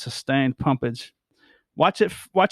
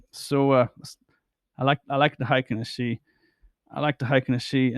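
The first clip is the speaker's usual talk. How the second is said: normal talking voice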